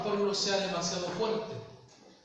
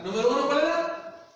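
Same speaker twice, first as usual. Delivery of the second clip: loud quizzing; 3 semitones higher, 4 dB louder than usual. Real, not acted. Preacher quizzing the congregation loudly.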